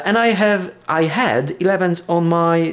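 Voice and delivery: ordinary delivery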